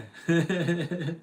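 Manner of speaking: high, quick burst of chuckles; a rippling high giggle; real laugh. Real, not acted. Streamer amused while chatting to viewers, high chuckle.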